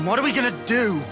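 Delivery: exasperated question. Acted. Pressed by friend's doubts, exasperated demand. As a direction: throw the question out in frustration. Frustrated argument about next steps.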